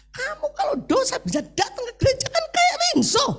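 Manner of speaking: high, scornful put-on boss voice; mocking boss. Corrupt boss scoffing at the offer of prayer, high mock sneer. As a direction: sneer at the refusal like a smug official.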